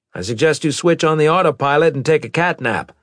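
commanding captain, high and loud; loud, high-pitched officer shutting down debate; brusque captain. A narrator's reading. Captain advises his sergeant.

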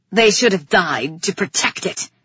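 loud, angry snap; snapping. A narrator's reading. King condemning his guards to his brother, snapping. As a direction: snap it as a harsh judgement. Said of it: "he snapped".

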